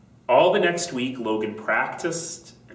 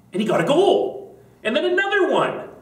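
excited narration; 4 dB louder than usual. A narrator's reading. Logan scoring goals.

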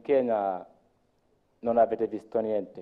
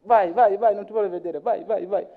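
high, hurried shooing of a policeman; mock cop brushing him off; impatient policeman; 8 semitones higher than usual. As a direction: wave the foreigner away fast. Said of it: "Lui subito".

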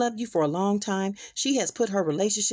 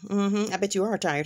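ordinary talk

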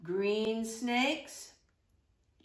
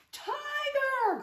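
startled. Acted. Shadow turns out tiger.

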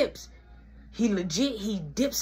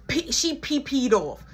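usual speaking voice

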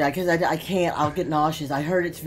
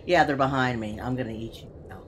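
low imitation of a scary monster, sinking softer; creepy monster; 6 semitones lower, 6 dB quieter than usual. Player retelling the monster's scary words to Trixie, creepy. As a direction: drop low and trail into a quiet threat. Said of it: "You were more scary with your words".

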